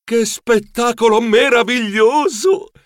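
high, slow marvelling exclamation; awed wonder. A narrator's reading. Geronimo reaches the top of the Duomo, awed. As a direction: stretch it out in amazement.

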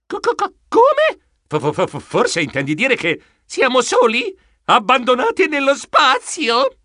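high-pitched frightened stuttering; stammer, then rise into alarmed disbelief; panicked stammer. Acted. Terrified stammer at bad news.

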